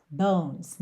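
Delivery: "Bones" as one syllable, E unsounded; silent E. Shown as a demonstration. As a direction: say "Bones" with no final E. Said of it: "não pronuncia a letra E". ("Bones" sem pronunciar o E)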